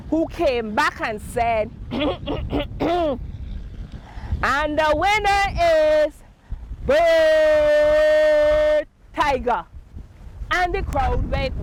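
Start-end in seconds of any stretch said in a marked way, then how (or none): 1.9-9.7 higher, louder, drawn-out announcement; Monkey announcing the winner; grand announcement. Acted. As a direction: stretch out the winner's name like an announcer.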